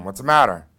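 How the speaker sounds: loud, firm repeat of the question. Retold or imitated. Dad fed up after tossing the pillow, loud firm question. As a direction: insist on an answer this time.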